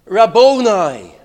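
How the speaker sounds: loud, slow, drawn-out cry; really emphatic. Retold or imitated. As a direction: call it out with full force. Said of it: "really emphatic".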